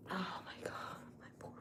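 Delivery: whispered to herself, hurting; pained whisper. Real, not acted. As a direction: breathe it out, no voice. Whispers about her hurt wrist.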